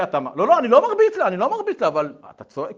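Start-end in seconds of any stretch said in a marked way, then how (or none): 0.4-1.9 loud, high protest; defensive denial. Retold or imitated. Father denies hitting his daughter, loudly defensive. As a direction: hurry to deny it, loudly.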